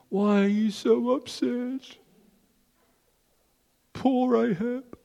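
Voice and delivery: high, mock-pitying queen's voice, "Poor Ahab" drawn out slowly; Jezebel's voice. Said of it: "And she says to him".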